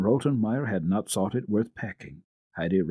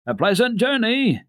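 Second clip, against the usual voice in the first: higher, louder friendly farewell call; 13 semitones higher, 6 dB louder than usual. A narrator's reading. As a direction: call out warmly after the carriage. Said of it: "in a friendly voice".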